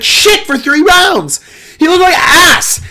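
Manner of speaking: high, loud, slow disgust; exasperated rant. Real, not acted. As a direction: exclaim in disbelief. Ranting about bad performance.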